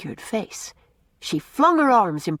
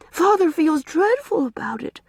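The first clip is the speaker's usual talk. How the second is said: lightly voiced earnest young girl, a touch higher; a small girl promising to be good; little girl pleading; 10 semitones higher, 4 dB louder than usual. A narrator's reading. Una pleads.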